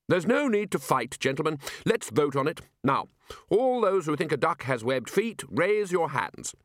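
high, slow, reasonable chairman; voice of reason. Acted. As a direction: calmly call for a vote. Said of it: "the voice of reason".

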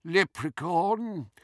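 put-on old goblin. A narrator's reading. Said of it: "said to nobody in particular".

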